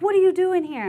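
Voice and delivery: high, loud demand; mock yell. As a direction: bark at the recruit. Drill sergeant yelling.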